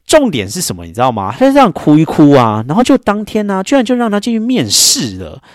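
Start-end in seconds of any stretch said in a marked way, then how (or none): none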